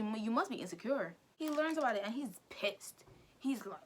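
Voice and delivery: ordinary delivery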